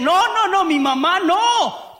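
high, loud, drawn-out protest; indignant denial. Acted. Spouse indignantly defending their mother in a mock fight. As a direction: refuse it outright, outraged.

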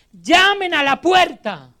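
loud, high, drawn-out command; shouted imperative. Retold or imitated. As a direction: call it out slowly and forcefully. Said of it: "son tres gritos del Señor Jesús".

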